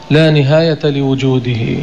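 ordinary talk

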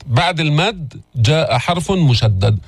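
usual speaking voice

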